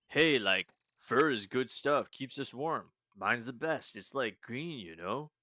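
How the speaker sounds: loud, slow stoner drawl; lazy burnout pride in his fur. Acted. Green meep bragging about his fur, slow stoner voice. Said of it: "like, stoners".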